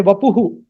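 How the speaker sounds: Vapuhu with the final hu sounded; South Indian visarga. Shown as a demonstration. Teacher quotes southern learners' form, Vapuhu with echoed hu. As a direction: add an echoed hu to end Vapuhu. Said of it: "they say".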